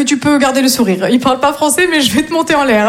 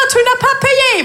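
much higher, called out after him; teacher calling after her fleeing date; 13 semitones higher, slower than usual. Retold or imitated. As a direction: shout it after the man leaving. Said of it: "puis moi j'en rajoute".

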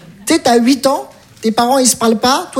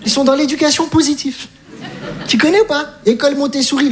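normal talking voice

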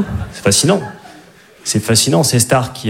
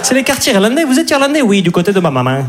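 put-on higher chatty Midi accent; southern French accent; 8 semitones higher than usual. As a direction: keep the New York romcom in a Marseille twang. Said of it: "avec l'accent du Midi".

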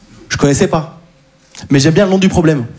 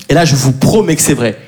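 ordinary talk, nothing marked

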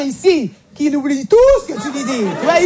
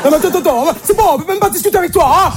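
put-on flustered boyfriend; 5 semitones higher, faster than usual. Lying boyfriend deflecting.

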